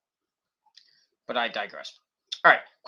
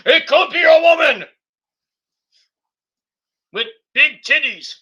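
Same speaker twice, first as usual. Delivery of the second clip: much higher and louder, leering yell; mock excited shout; 9 semitones higher, 7 dB louder than usual. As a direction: shout it like a dirty old man. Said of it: "And Vince is like".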